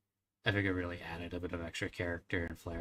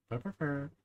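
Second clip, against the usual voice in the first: higher trilled purr; playful verbal tic, purred high; little purring sound; 6 semitones higher than usual. Real, not acted. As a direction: roll out a silly little purr. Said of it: "just small little sounds".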